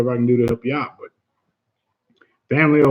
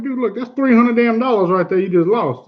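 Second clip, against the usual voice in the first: mildly irritated retold scolding, pitched higher; kinda pissed off; 6 semitones higher than usual. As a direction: replay a dad's annoyed telling-off. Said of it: "I got kinda pissed off".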